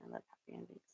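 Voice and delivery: whispered aside. Real, not acted. Vlogger confiding love of happy endings, whispered. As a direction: breathe it out, no voice. Soft aside after story.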